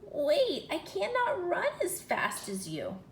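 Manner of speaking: high, loud frog calling after her; croaking frog call. Acted. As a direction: croak it out, pleading to be waited for. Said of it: "The frog croaked after her".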